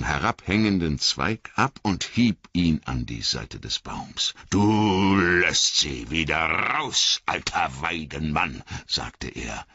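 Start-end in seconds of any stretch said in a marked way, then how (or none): 4.5-8.6 slow, firm command to the tree; stern old Tom. Acted. Tom Bombadil ordering the willow to release them, stern and slow. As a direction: order it sternly, each word weighted.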